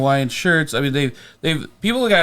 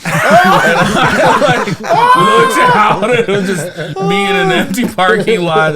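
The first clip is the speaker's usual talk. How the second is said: high, loud laughter through the words; can't hold back the laughter while telling it; laughing; 10 semitones higher, 6 dB louder, slower than usual. Real, not acted. Recalling waking alone in the empty lot, laughing loudly.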